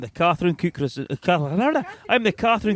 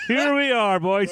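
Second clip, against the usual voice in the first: laughing; 5 semitones higher, slower than usual. Real, not acted. Laughing line.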